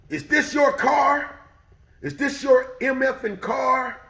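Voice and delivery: high, loud, slow demanding bark; angry old man. Retold or imitated. The old man in the doorway demands to know whose car.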